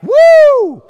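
loud, very high woo; whoop. Retold or imitated. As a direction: let out a piercing retold whoop. Retold reaction after weird encounter.

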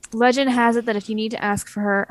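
ordinary talk

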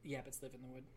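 "yabbits" in cartoon baby-talk, deadpan; "yabbits" for rabbits. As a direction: say "yabbits" with a y for the r, soft and low. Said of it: "Instead of rabbits".

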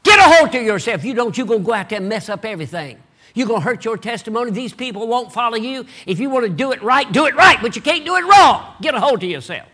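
loud, high rebuke to himself; stern self-scolding. Acted. Nehemiah scolding himself to keep control, loud and stern. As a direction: lecture yourself like an angry coach.

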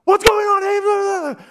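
slow, high, loud drawn-out yell; yelling sailors. Acted. Sailors yelling at Jonah over the storm, loud slow high cry. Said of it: "they're all yelling at the same time".

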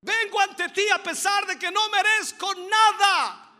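loud, high, desperate begging; pleading shout. Retold or imitated. The woman begging Jesus, loud desperate plea. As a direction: shout it as someone who deserves nothing. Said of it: "diciendo y gritándole".

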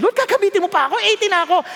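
mock old person. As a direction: play an elderly man asking the Lord. Pastor voices elderly person complaining.

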